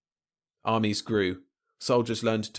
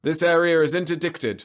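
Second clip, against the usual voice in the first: high, loud official interruption; patrol breaking in; 8 semitones higher, 6 dB louder than usual. A narrator's reading. Patrol interrupting the freighter's tirade, loud and high. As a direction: cut across them with an official warning. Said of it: "the patrol broke in".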